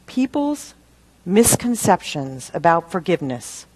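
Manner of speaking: normal talking voice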